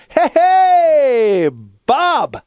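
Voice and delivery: put-on very high, loud baby call; toddler voice. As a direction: squeal the name like a delighted one-year-old. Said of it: "he turns to me, and he goes".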